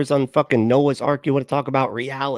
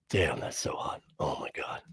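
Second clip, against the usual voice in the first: whispered guilty thrill; mock secret whisper; 8 semitones lower, 12 dB quieter than usual. A man secretly browsing at night, whispering. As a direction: whisper like sneaking a forbidden look.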